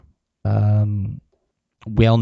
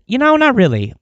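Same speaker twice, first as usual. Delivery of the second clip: breezy brush-off; 14 semitones higher, 4 dB louder than usual. Retold or imitated. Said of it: "I said".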